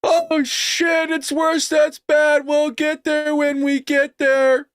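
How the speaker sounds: high, drawn-out mocking voice; mock officials' response. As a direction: play the useless official, high and dragging. Acting out unprepared officials' response.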